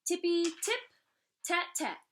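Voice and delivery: playful drum noises; light rain drumming. A narrator's reading. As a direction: patter it like rain on a window.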